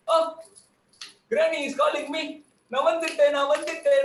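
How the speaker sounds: ordinary delivery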